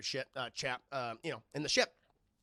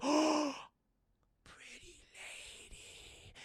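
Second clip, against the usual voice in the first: slow unvoiced whisper; creepy whisper; 9 semitones higher, 3 dB quieter, much slower than usual. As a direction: breathe it out like a dazed brute. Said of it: "she's like".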